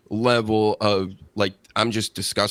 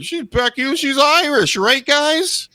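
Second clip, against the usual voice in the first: high, mock-cheery announcer voice, pitched way up; mocking hype intro; 13 semitones higher, 3 dB louder, slower than usual. Podcaster mocking the crowd-pleasing Becky intro in a high, hammed-up voice. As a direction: ham up a cheesy introduction.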